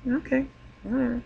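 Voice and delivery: high, soft, light okay whatever; quiet dismissive aside; soft shrug. Real, not acted. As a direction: let it go under your breath. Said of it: "I don't mind if he wants to hug me".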